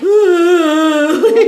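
breaks into a high, loud laugh; laughter spills over the words. Real, not acted. Cohost reacting to the disgusting weeping, laughing. Reacting to gross crying story.

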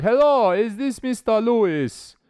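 high, slow and formal, imitating the caller; caller's voice. Man retelling a call from the party official, high slow voice. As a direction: play the stiff official on the phone.

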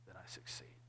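hushed confession, breath only; whisper. Real, not acted. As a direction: breathe it out as a private admission. Confessing his own failures.

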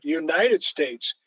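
"United" stressed, slow and drawn out, "States" falls away. Shown as a demonstration. Quoting a woman on how people now say the country's name, slow stress on "United". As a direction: lean hard on "United", said slowly. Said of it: "the emphasis is on the first word".

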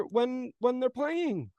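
mock announcer voice. Still in announcer voice.

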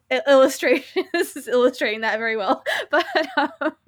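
laughing through the words, fast; can't stop giggling at yourself. Real, not acted. Laughing at own social anxiety.

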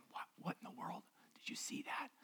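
very soft, whispered mock muttering; background muttering. Onlookers muttering to themselves about the waste, whispered muttering. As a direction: whisper it as if grumbling under your breath. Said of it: "sort of muttering in the background".

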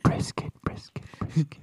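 whispering. Pretend. Echoing the word softly.

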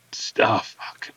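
whispered dismay; whispered curse. Real, not acted. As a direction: breathe the curse out under the breath. Whispered reaction to bad pick.

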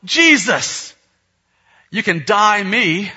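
high, loud holler, slowing at the end; brash mock child's shout; imitated kid calling heavenward. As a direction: call up to heaven like a bold little boy. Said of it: "he looks up at the ceiling".

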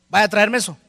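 loud, domineering command; imposing. Acted. Spouse ordering the other to fetch something, imposing and loud. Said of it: "le habla en un tono, eh, eh, ¿cómo se dice? Imponente".

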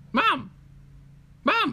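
high-pitched cub calling Mom; baby polar bear. Acted. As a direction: call out in a squeaky cub voice. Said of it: "little baby polar bear says".

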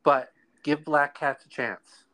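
ordinary talk, nothing marked

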